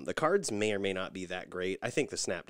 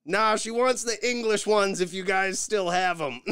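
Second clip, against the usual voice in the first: much higher, louder mock-snide retort; sarcastic reply; 12 semitones higher, 8 dB louder than usual. Husband snaps back at the cashier, loud and mocking. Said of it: "So I go".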